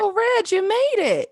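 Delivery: very high, singsong welcome; delighted greeting. Real, not acted. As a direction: call out high to welcome a late arrival. Welcoming the guest who just joined.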